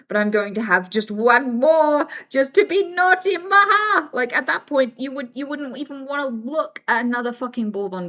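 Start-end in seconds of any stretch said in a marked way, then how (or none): 0.1-4.1 hammed-up gleeful indulgence, rising high and loud; mock naughty. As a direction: build to a silly mischievous cackle. Acts out a naughty biscuit indulgence.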